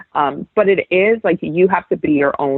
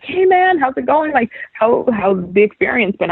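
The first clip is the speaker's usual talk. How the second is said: bright high greeting, much higher pitch; upbeat colleague greeting; 9 semitones higher than usual. Retold or imitated. Colleague James welcomes her at the Super Bowl, high cheerful greeting. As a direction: greet a new coworker like a happy buddy. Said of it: "he's like".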